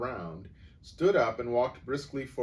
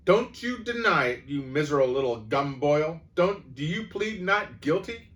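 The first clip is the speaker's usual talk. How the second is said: much higher, louder angry grilling; furious accusation; 7 semitones higher, 9 dB louder than usual. A narrator's reading. Headmistress grilling the boy, furious. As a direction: demand a confession.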